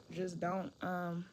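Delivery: ordinary talk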